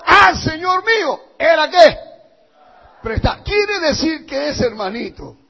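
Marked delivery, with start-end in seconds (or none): 0.1-2.0 high, loud cry of alarm. Retold or imitated. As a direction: call out to the master, pitched right up. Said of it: "Y gritó diciendo".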